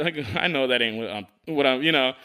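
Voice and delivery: ordinary talk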